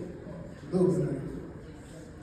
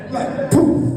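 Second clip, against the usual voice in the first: mock engine pop; 3 semitones higher, 8 dB louder than usual. Lawnmower starting noise.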